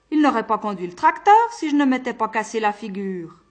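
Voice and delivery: loud, high gleeful reveal; spring the surprise, delighted; triumphant reveal. Acted. Old farmer revealing he caused the accident, loud and gleeful.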